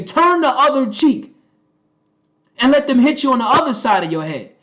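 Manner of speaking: mocking quote. Mocking Jesus's words to congregation.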